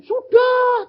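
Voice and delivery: God's high reply. Retold or imitated. God answers in retold vision.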